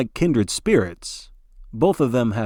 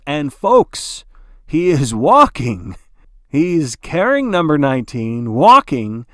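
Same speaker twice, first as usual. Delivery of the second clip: announcer building louder and louder; rising excitement; 3 semitones higher, 7 dB louder than usual. Acted. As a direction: swell with awe as he lifts him. Announcer thrilled by the rescue.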